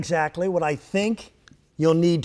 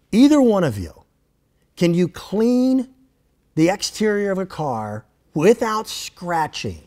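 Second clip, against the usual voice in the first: debate moderator voice; 4 dB louder than usual. Acted. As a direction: pose the question like a TV moderator.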